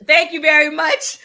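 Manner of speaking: beam your thanks out; delighted thanks. Real, not acted. Host loudly thanks a viewer who finally answers. Thanking chat, then laughing.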